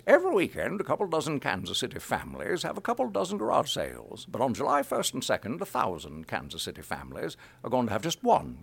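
put-on elderly New England announcer, swinging pitch; old New England man. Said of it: "like an old man from New England".

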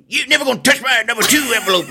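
put-on high, fast sing-song old miner guarding treasure; old prospector. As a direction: cackle it like a greedy old-timer. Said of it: "I turn into, like, an old prospector".

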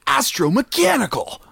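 squeaky high anime-style delivery; high-pitched anime hero calling out the word. Pretend. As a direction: say it like an over-the-top anime character. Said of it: "Say that most anime you can".

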